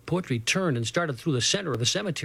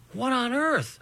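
higher, thought-like disbelief; inner astonishment; 8 semitones higher, slower than usual. A narrator's reading. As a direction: think it in baffled wonder. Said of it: "I kept thinking".

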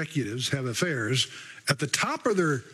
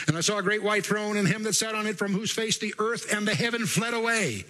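higher, level-pitched recital; scripture reading; 6 semitones higher than usual. Acted. Preacher reads Revelation to the congregation, scripture reading. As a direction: declaim the verse evenly from the page.

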